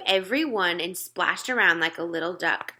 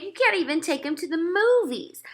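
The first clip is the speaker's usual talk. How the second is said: imagined mother; 10 semitones higher than usual. Retold or imitated.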